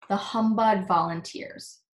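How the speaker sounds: ordinary delivery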